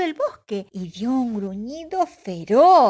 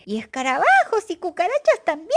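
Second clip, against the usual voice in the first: higher, brighter eager question; eager Pepe; 9 semitones higher, 4 dB louder, faster than usual. Acted. Pepe asks his mother about the bug cake, eager and high. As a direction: delight in the gross treat. Pepe adds more bugs.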